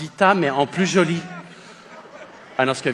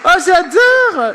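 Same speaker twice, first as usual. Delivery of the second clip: delighted; 13 semitones higher, 7 dB louder, much slower than usual. Real, not acted. Comedian delighted by costume.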